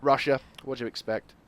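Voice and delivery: ordinary talk, nothing marked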